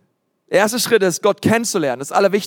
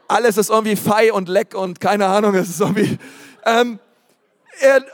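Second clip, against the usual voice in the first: ordinary delivery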